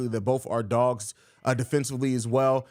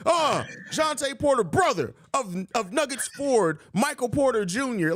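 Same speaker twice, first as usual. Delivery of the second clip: radio announcer; 9 semitones higher than usual. Retold or imitated. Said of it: "Vern talks like a radio announcer".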